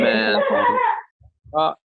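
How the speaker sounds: high delighted exclamation, a real cry of joy. Real, not acted. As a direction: burst out in praise. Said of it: "ما شاء الله، تبارك الله".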